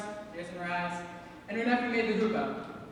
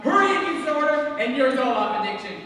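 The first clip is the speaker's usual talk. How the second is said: building rant; 4 semitones higher, 7 dB louder than usual. Real, not acted. Escalating marriage breakdown rant.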